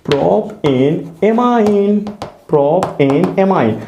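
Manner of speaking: drawn out syllable by syllable; teacher dictating a name slowly; slow dictation. Shown as a demonstration. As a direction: spell it slowly for the class to follow. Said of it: "follow कराओ".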